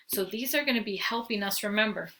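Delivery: normal talking voice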